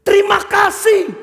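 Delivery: high, drawn-out exclamation; emphatic thanks. Acted. Engineer thanks the worker who saved him, exclaiming. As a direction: burst out with heartfelt gratitude, slowly.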